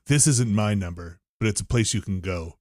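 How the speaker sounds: a lightly voiced weird salesman; low mock salesman voice. Retelling to podcast friends, low mock salesman voice. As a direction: voice dropped low and soft for the odd salesman.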